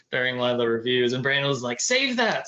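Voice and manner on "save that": high, bright retold call; pitched way up, eager; excited exclamation.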